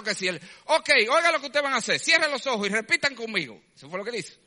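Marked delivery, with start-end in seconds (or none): 0.7-3.6 high, loud hawker's question; the jeep man calling out to the domino players, loud and high. Retold or imitated. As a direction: pitch it like a street preacher. Jeep man gives instructions.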